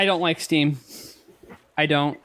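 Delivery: ordinary talk, nothing marked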